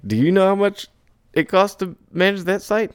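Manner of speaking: high and loud, incredulous; exasperated outburst. Acted. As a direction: burst out at the client in disbelief. Exasperated reply to client.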